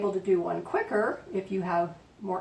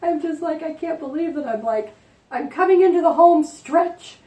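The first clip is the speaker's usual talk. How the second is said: high, loud burst of giddy excitement; real excitement; 9 semitones higher, 9 dB louder than usual. Real, not acted. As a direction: gush excitedly, pitch and volume climbing. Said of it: "I'm so excited about this".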